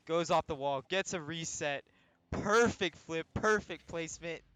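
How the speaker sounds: loud, quick hype call; excited play-by-play. Real, not acted. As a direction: call every touch with energy. Live play-by-play of the goal.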